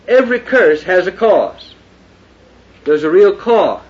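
high, loud pulpit preaching; fiery preaching. Acted. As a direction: thunder it from the pulpit. Preaching at full force.

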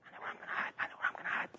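hushed, conspiratorial whisper; children's whisper. Retold or imitated. Children plotting their hiding place together, whispering. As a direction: whisper it like a kid with a secret.

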